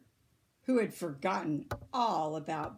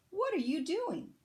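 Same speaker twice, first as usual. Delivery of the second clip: much higher, eager rabbit question; 11 semitones higher than usual. A narrator's reading. Rabbit asking Bird what he's doing, high and eager.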